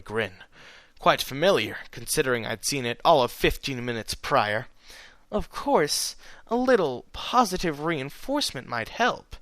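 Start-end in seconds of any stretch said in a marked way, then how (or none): 5.3-9.4 teasing, lightly hushed; playful mare whisper. A narrator's reading. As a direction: sly tease. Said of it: "she whispered".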